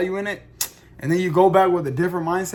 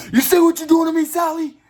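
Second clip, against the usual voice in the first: mock outraged shout; 12 semitones higher, 7 dB louder than usual. Acted outburst at Sally's word problem.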